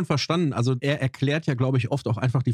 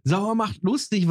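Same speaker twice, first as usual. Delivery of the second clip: mocking quote; 6 semitones higher, slower than usual. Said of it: "Was ist das für ein bekloppter Spruch?".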